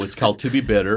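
ordinary talk, nothing marked

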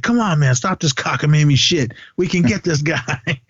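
mock a partner with zero sympathy; no compassion. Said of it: "no, no compassion".

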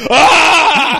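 loud, high comic scream; mock scream. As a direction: scream in hammed-up panic at the sight of food. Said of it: "he just starts screaming".

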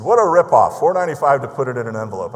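hammed-up angry ripoff complaint; mock outraged customer. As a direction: bark it out like a customer who feels cheated. Angry customer's email acted out.

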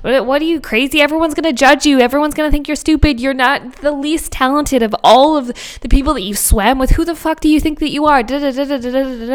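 high, relentless self-berating rant; intrusive thoughts. Acted. Her inner critic attacking her, scornful and rapid. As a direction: pile on scornful doubts without a breath. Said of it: "all the intrusive thoughts come in".

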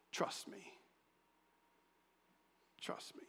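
breathy whisper of God; hushed voice of God, urging trust. Acted. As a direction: whisper it like a secret promise.

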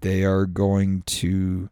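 normal talking voice

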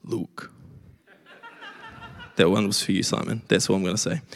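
a playful low preacher voice; dark deep voice. Pretend. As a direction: drop into a mock-serious bass. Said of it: "I'll put on my, m-, my dark, deep voice".

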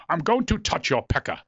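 goofy send-up of a stately actor; Patrick Stewart. Acted. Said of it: "doing my stupid impression".

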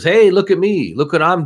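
high, loud attention-seeking call; mock show-off. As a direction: crow it like someone begging to be noticed. Acting out showy givers seeking notice.

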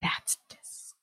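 disgusted whisper. Real, not acted. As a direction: breathe it out in disgust.

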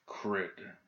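understated, quiet growl of displeasure. A narrator's reading. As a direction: growl "Crud" quietly under your breath. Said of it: "the governor growled".

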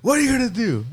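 put-on high, cocky little-kid challenge; a small boy daring the scare actor; kid taunting. Kid taunting a masked scare actor, high and cocky. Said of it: "He's like".

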